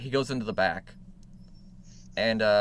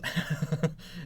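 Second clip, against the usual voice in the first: higher-pitched acted chuckle; 6 semitones higher, 4 dB quieter than usual. Tailor ribbing a regular customer, chuckling. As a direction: chuckle to yourself in character. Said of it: "He chuckles".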